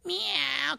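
high cat meow. Acted. Cat luring mouse.

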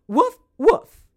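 high, loud playful woofs; mother mouse barking. Acted. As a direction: bark proudly like a dog. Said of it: "speak another language".

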